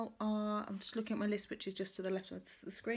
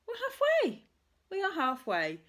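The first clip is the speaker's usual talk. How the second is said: excited; 10 semitones higher, 7 dB louder than usual. Real, not acted. Celebrating reaching the halfway mark.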